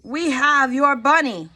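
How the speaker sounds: a kidnapper's call, lightly; man's voice on phone. Acted. Stranger on the phone to daddy, mock-ominous. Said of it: "said a man's voice".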